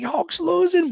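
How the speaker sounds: put-on high, whiny kid voice; a ten-year-old in despair; upset kid. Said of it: "I'm like".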